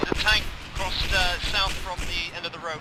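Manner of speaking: high, slow alarmed report; excited callout. Real, not acted. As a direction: call out the tank urgently. Spotting a tank in combat.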